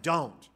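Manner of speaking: a firm, clipped Don't! as a written note; exclamatory don't. Retold or imitated. The girl's note warning her suitor off, a sharp Don't!. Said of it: "exclamation point".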